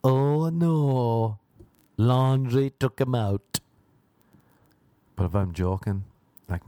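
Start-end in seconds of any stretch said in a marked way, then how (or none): 0.1-3.6 louder, slow put-on dismay; mock lament. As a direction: wail it like a hammy mourner. Friends' mock mourning.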